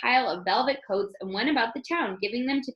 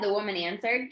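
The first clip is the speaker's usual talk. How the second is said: ordinary delivery, nothing marked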